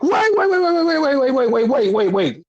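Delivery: rapid-fire high waits, easing down in pitch; frantic interjection. Retold or imitated. Retelling Renee stopping a wrestler from leaving, frantic. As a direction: imitate a panicked stream of waits, starting high.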